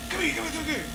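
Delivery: hushed calling over. Retold or imitated. Calling coworker over in airport.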